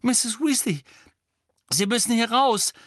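high, loud urgent call. A narrator's reading. Harry calling urgently to Mrs Weasley. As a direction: shout to get her out. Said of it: "rief Harry".

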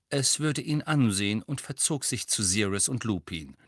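ordinary delivery

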